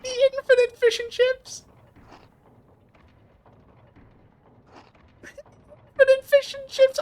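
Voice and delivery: very high, giddy squeaky voice; high-pitched gleeful outburst; delighted squeal. Real, not acted. Delighted by endless food animation.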